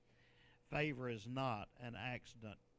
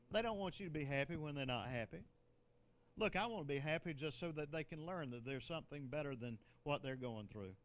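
normal talking voice

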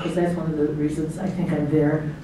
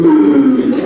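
mock gasping; 7 semitones higher, 8 dB louder than usual. Kids out of breath at top.

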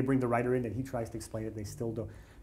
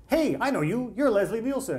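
much higher, excited fanboy greeting; starstruck fan; 10 semitones higher, 3 dB louder than usual. Retold or imitated. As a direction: blurt it out, starstruck. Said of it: "And I'm like".

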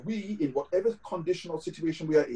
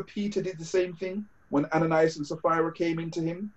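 ordinary talk

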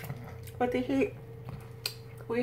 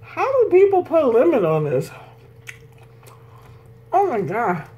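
disgusted; 4 semitones higher, 5 dB louder than usual. Real, not acted. Disgusted at sour taste.

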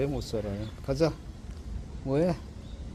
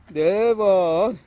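talking to a dog; 6 semitones higher, 9 dB louder, much slower than usual. Real, not acted. Counting dog's rolls.

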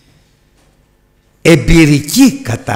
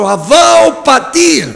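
high, slow, ringing cry of «Αβά ο Πατήρ»; crying out to God; 12 semitones higher, 5 dB louder, slower than usual. Acted. Preacher voicing the believer's cry to God, a high slow call. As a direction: call out to the Father from the heart. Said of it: "και φωνάζω".

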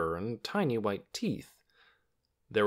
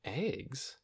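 soft questioning echo, slightly raised; puzzled echo; 4 semitones higher, 9 dB quieter than usual. A narrator's reading. Lena doesn't know the word, puzzled echo. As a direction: quietly wonder at EGGS, rising at the end.

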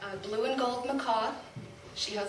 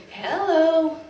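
a louder squawked hello, higher; loud parrot call; 6 semitones higher, 10 dB louder than usual. Retold or imitated. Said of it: "she'll say".

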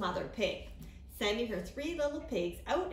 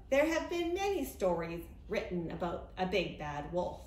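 ordinary delivery

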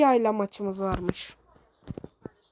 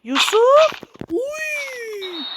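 much higher, louder yell of the name; real excited yell; excited shout; 11 semitones higher, 3 dB louder, much slower than usual. Real, not acted. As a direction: scream the name at the screen. Goal chance, yells player's name.

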